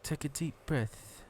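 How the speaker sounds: very slow, hushed guided-meditation voice; soft and drawn out, each word spaced; put-on meditation guide. Shown as a demonstration. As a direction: lead them into calm, slowly and quietly. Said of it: "why are you saying that accent".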